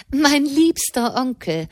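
calling out. A narrator's reading. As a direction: catch her uncle's attention. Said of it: "rief Madame".

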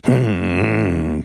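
low grumbled hum; nervous grumble. A narrator's reading. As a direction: grumble it under your breath, on edge. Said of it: "brummte Pepone, der entsetzlich nervös war".

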